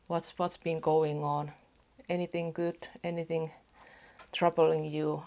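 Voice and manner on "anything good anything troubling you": usual speaking voice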